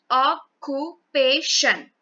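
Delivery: "Occupation" very slowly, broken into four syllables. Shown as a demonstration. Teacher showing how to syllabify the word, syllable by syllable. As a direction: say "Occupation" in separate beats, a gap after each syllable. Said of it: "syllabify the word occupation".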